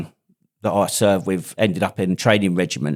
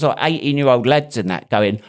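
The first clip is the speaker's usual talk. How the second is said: usual speaking voice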